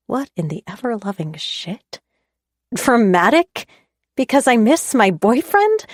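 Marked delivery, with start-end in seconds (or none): 2.7-5.9 indignant. A narrator's reading. Indignant retort in quarrel.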